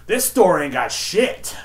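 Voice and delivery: high, loud, drawn-out mock complaint; indignant customer. Customer runs down the store to the clerk, indignant. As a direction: imitate a disgusted customer, dragging it out.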